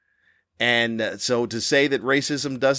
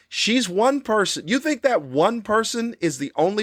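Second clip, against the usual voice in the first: higher, scornful repeat of one person; incredulous retort; 9 semitones higher than usual. Real, not acted. Host mocking the outlier excuse, higher and scornful. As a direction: throw the excuse back in disbelief. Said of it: "She's just one person".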